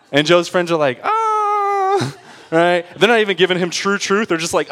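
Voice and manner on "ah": high sustained mock wail; friends' alarmed high-pitched "ahh"; drawn-out "ah". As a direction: let out one long, high "ahh". Friends react to Job's boast.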